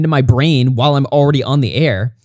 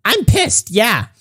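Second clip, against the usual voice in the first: higher-pitched mocking quote of an angry critic; 6 semitones higher, much slower than usual. Streamer quoting his angry critic to viewers, mock angry. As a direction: imitate his outburst, pitched up. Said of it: "Then he's like".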